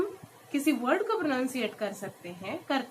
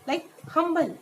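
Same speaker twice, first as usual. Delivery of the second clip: ordinary talk, nothing marked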